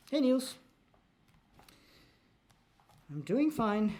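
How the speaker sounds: sped-up, high-pitched squeaky voice; chipmunk voice. Real, not acted. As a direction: sounds like a chipmunk. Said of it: "I sound like a chipmunk".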